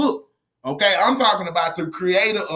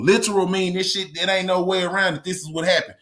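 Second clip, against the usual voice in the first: ordinary talk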